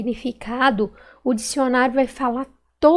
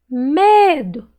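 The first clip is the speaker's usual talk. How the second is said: "Medo" with a loud, long ME; stretched stressed syllable; 2 semitones higher, 5 dB louder than usual. Shown as a demonstration. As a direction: call out "Medo", holding ME. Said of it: "Qual que eu demorei mais falar".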